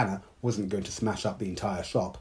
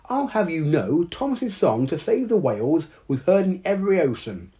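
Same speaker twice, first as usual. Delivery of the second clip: much higher, louder proud duck voice; indignant duck; 9 semitones higher, 5 dB louder than usual. Acted. Bertie the duck to Dao, indignant, high proud quack. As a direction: puff up and defend your friend. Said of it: "drew himself up to his full height".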